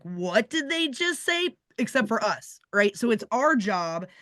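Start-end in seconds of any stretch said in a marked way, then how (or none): 0.0-1.6 slow, high and baffled; as if you can't believe what you just heard. Retold or imitated. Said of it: "anyone who would be like".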